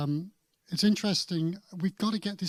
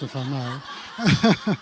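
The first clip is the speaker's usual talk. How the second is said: laughing; 6 dB louder, much slower than usual. Real, not acted. Defending his accent, laughing.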